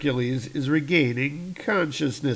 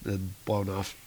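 ordinary talk

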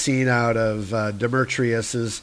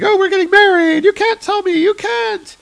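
very high, louder, fast falsetto wail; hammed-up sobbing denial; 6 dB louder than usual. Hannah refuses the news of Jake's death, very high and wailing. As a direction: wail it in mock tearful denial. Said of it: "and Hannah's crying".

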